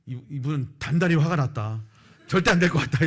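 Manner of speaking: retold dismay at full volume; emphatic bad news. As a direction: deliver the hopeless verdict forcefully. Senior reports the boss's fury.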